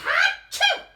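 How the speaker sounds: giant acted sneeze, loud and high; huge sneeze. Wolf sneezing the straw house down, huge loud sneeze. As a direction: blast out a theatrical achoo.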